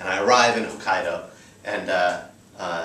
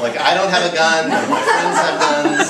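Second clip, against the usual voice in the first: louder, laughing through the denial; laughing protest; 6 semitones higher, 7 dB louder than usual. Real, not acted. Storyteller denying gun ownership to the audience, laughing. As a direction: protest while cracking up.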